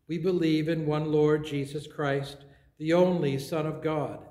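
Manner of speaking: usual speaking voice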